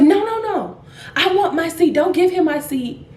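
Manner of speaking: high-pitched mock protest; retold passenger digging in, pitch jumping up; indignant refusal. Passenger refusing to give up her seat, indignant protest.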